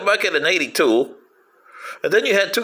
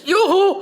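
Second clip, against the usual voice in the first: whoop; 13 semitones higher than usual. Acted. Cheerful whoop.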